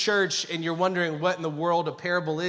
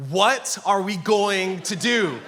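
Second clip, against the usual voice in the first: slow, louder, drawn-out alarm; mock inner panic; 3 semitones higher, 5 dB louder, slower than usual. Husband's internal dialogue on becoming a father, mock panic. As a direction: stretch each word out in comic panic. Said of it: "Internally, my dialogue is like".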